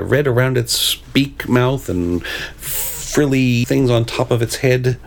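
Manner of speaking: usual speaking voice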